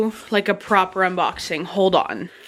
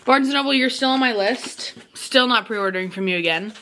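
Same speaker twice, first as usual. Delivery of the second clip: ordinary talk, nothing marked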